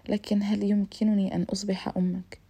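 usual speaking voice

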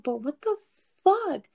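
what the fuck jumps high and loud; indignant loud question; outraged. Retold or imitated. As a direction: burst out at fuck. Outraged at the cheater.

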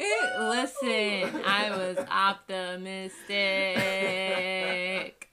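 slow drawn-out. Real, not acted. Drawn-out laughing insistence.

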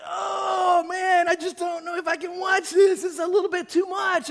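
high, loud hammed-up dismay; overwhelmed, half-laughing protest; mock distress. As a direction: squeamish viewer protesting the torture scenes. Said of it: "it was like".

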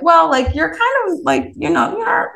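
high-pitched, hesitant hedge; tactful hedging. Retold or imitated. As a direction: ease into an awkward remark, voice lifted. Softening an awkward judgement in retelling.

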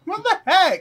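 very high, loud baffled exclamation; high-pitched incredulous reaction; baffled disbelief. Real, not acted. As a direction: squeal it in disbelief. Reacting to the strange story.

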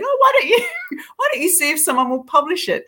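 high-pitched proud mum-and-dad voice; voiced parents. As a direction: mimic encouraging parents in a high, amused voice.

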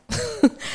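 quick high burst of laughter; a brief real laugh; short laugh. Real, not acted. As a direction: let a laugh break out after it. Bitter laugh after telling off.

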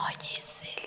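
hushed, unvoiced whisper; whispered aside. Therapist whispering about a lookalike patient's boyfriend, secretive whisper. Star-struck aside to audience.

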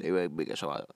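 a hint of relaxed street swagger; toned-down slang. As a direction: drop the voice into casual slang. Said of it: "Le, le bajan al".

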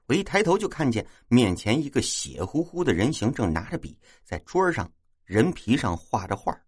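ordinary delivery, nothing marked

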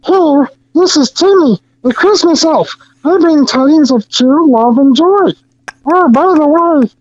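high, chirpy little elf; squeaky holiday elf greeting; Christmas elf voice. Retold or imitated. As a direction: play a cheery Christmas elf. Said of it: "I go".

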